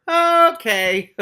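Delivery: high, loud mock acceptance; loud ironic okay. Real, not acted. Reader reacting to the tail news, loud and high. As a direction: throw it out in disbelief.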